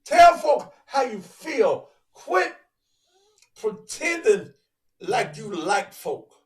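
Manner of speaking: loud and slow, like a fired-up preacher; preaching shout. Acted. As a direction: hammer it at the crowd, slowly.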